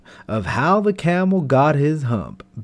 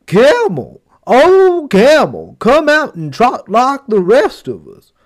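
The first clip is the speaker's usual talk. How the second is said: high, loud drawn-out call; calling horse; 8 semitones higher, 7 dB louder than usual. Acted. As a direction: call out coaxingly across the desert. Horse calls to the camel.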